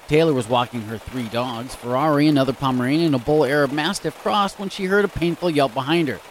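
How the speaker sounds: usual speaking voice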